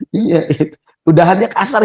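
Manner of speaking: high, amused outburst; laughing. Real, not acted. Speaking on through his laughter.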